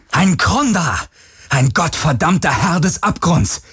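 high, raging commands; furious command. Acted. Galen commands the shadow weapon in rage.

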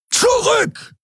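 very high, urgent yell; roared command. A narrator's reading. Keru warns Miko and Nelen of danger, roaring. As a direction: bellow an alarmed order to get clear. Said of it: "brüllte er Miko und Nelen an".

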